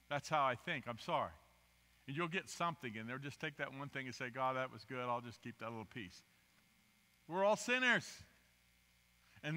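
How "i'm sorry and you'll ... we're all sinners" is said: normal talking voice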